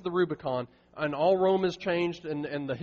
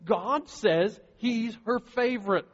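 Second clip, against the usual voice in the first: loud, high, slow proclamation that keeps climbing; impassioned preaching; 7 semitones higher, 6 dB louder than usual. Acted. Pastor to the congregation about Mary, impassioned full-force preaching.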